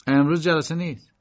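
put-on the young washerwoman turning him away; woman's voice. Young woman telling K no session today, firm. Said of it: "زن گفت".

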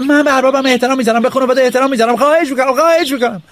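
put-on boy shouting his loyalty to the house; loud begging. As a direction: call up so the balcony hears. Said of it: "با صدای بلند".

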